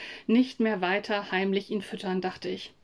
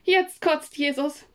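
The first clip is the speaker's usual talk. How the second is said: ausgerufen; 5 semitones higher, 4 dB louder, slower than usual. Acted. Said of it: "rief ich".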